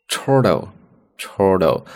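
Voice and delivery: ordinary talk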